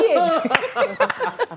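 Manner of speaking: bright laugh, then a laughing yeah. Real, not acted. Laughing after retold shout.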